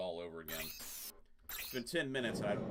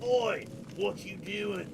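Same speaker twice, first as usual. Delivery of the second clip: a higher, hammed-up British accent on 'Oi'; mock British accent; 8 semitones higher, 6 dB louder, much slower than usual. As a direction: play a British street thug with a put-on British accent. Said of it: "we'll talk with accents".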